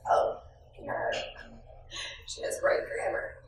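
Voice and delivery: whispered impressed aside. Retold or imitated. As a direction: whisper it admiringly. Guests whispering approval.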